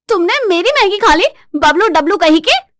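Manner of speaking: high, loud wife's accusation; outraged high-pitched shout; angry yelling. Acted. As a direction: yell at the food thief. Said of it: "क्यों चिल्ला रही हो".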